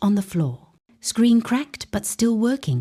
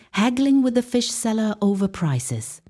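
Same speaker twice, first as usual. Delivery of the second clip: ordinary delivery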